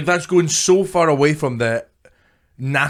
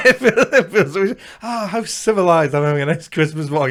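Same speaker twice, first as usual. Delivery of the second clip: laughing; 5 semitones higher than usual. Pretend. Mock posh laughing.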